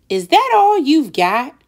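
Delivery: lightly voiced, unimpressed little boy; scoffing boy. Wendell scoffing at Sophie's toys, a mild sneering boy. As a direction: scoff at the toy chest.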